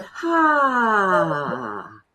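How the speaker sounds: long audible sigh out. Shown as a demonstration. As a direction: breathe out with a sigh. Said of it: "and sigh it out".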